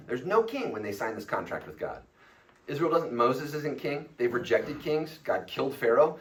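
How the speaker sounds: ordinary talk, nothing marked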